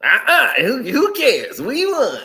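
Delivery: high, loud smug brushing-off voice; mock fan shrugging off cheating. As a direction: toss it off like a smug winner. Mocking chat's smug reply.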